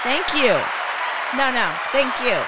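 high and loud, hamming up gracious thanks; mock proud thanks. Host hams up thanks after daughter's praise, mock-gracious. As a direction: gush thanks like accepting an award.

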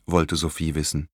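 ordinary delivery, nothing marked

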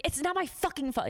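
high, indignant mock snap; imitated defensive outburst; snapping. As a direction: snap back as the fed-up boss. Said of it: "he just, like, snapped at me like that".

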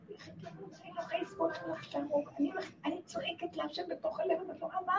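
very soft, high murmur; inward cry. Shown as a demonstration. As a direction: speak it secretly, as if inside the heart. Demonstrates silent inner cry to God.

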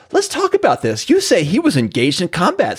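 pointed mock cross-examination; imitated cross-examining lawyer. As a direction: press the witness as a retold lawyer. Retold lawyer's cross-examination opening.

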